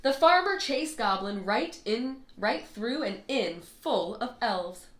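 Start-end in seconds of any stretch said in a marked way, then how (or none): none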